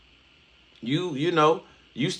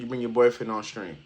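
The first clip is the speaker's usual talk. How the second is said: usual speaking voice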